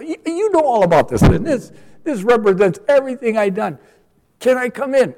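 man at heaven's gate pleading to God, high and insistent; high pleading self. Pretend. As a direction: beg God to let you in. Pleading his case before God.

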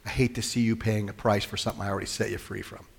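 soft, pained tenderness; tender sorrow. Retold or imitated. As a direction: say it like a grieving father. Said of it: "That's the disappointment, is".

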